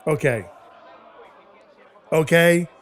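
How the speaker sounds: ordinary talk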